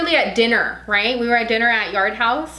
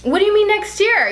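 incredulous; 10 semitones higher than usual. Real, not acted. Wife to husband promising to post next year, incredulous. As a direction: pounce on it in disbelief.

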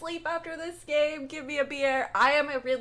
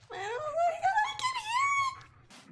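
much higher, crying-edged whimper; frightened whimper; 3 dB quieter, much faster than usual. Real, not acted. Gamer hearing the monster approach, frightened whimper. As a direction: panic as the sound gets close. Hears something, panics.

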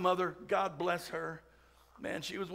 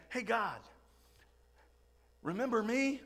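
sheepish David. Retold or imitated. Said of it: "and he's saying".

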